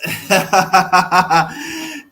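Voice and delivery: big burst of laughter; hearty laugh. Real, not acted. Laughing at his own threat to the fans.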